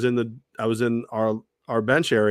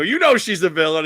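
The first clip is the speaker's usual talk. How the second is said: higher, louder teasing; playful tease; 7 semitones higher, 7 dB louder than usual. Retold or imitated. Host retells teasing the son, higher and louder. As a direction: rib the kid about his mom. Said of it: "I go".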